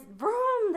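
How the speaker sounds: a loud, high imitated thunder boom; vocal thunder effect; thunder vroom. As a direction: shout the thunder noise.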